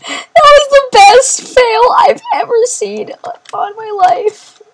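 very high, squealing reaction; high-pitched, cracked-up outburst; hysterical excitement. Real, not acted. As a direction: lose it over an epic fail. Cracking up at game fail.

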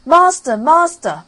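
high, loud cry of Master; the harp shouting for help; loud call. Acted. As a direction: cry out in alarm to wake him. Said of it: "called out quite loud".

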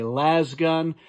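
lasgun said "lass-gun"; "lass" pronunciation of lasgun; las with short A. Shown as a demonstration. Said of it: "some people like to say".

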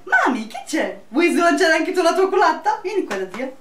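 high cooing pet voice. Real, not acted. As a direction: coo at the dog lovingly. Talking to pet mid video.

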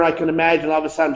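normal talking voice

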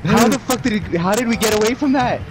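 raging gamer. Real, not acted. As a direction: fume at the unfair hit. Angry about being hit.

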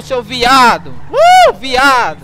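drawn-out furious yell. Real, not acted. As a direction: scream the insult at him. Trash-talk quarrel over voice chat.

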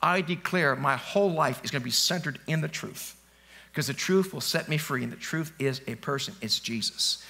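hushed demo prayer to the Lord; quiet prayer. Shown as a demonstration. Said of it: "So this, say".

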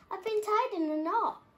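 lightly voiced toy in distress; upset character voice. A narrator's reading. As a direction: wail about being knotted. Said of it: "barked Herman".

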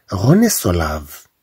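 slow, clear French sentence; slow dictation pace; slow model reading. Shown as a demonstration. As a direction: read it slowly for the class. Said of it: "reading example sentence".

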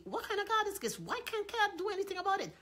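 high, puzzled protest; incredulous. Retold or imitated. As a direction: ask in disbelief like the crowd. Imitates puzzled listeners.

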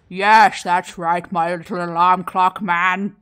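low, loud goblin king voice; King Growl. Acted. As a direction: boom it proudly at a little minion. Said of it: "King Growl goes".

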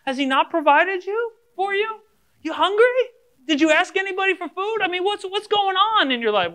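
high, pressing string of questions; mock incredulous questioning. Questioning a broke believer's complaint, high mock incredulity.

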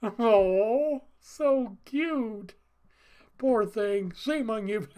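put-on high, gushing baby-talk coo; cooing sympathy. Onlooker reacting to baby crying at pastor, cooing. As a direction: coo over a poor little baby, then playfully scold.

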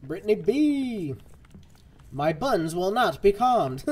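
loud, high, drawn-out proclamation; hammed-up protest to a viewer; slow, booming mock indignation; mock defiant outcry.

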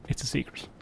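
very soft, hushed whisper; secretive whisper. Pretend. Keeping his build secret from chat.

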